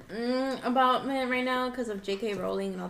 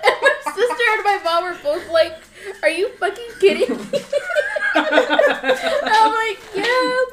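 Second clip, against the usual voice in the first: laughing; 11 semitones higher, 9 dB louder than usual. Real, not acted. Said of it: "laughing through the story".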